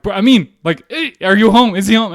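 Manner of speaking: loud, high calls through a door; urgent calling out. Retold or imitated. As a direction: act out frantic shouting at the doorstep. Calling out at friend's apartment door.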